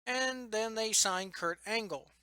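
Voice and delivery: ordinary talk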